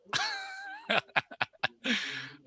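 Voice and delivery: short burst of laughter; amused laugh. Real, not acted. Laughs at sarcastic chat comment.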